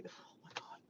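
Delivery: a whispered oh my God; exasperated whisper. Real, not acted. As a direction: breathe it out in despair. Stumbling over numbers.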